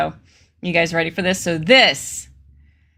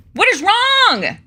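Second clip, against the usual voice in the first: dramatic high repeat; 13 semitones higher, 3 dB louder, slower than usual. Retold or imitated. Commentator hamming up Lee's indignant question, high and slow. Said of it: "quoting Lee".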